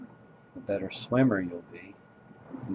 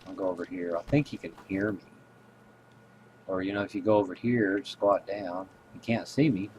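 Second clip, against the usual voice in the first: usual speaking voice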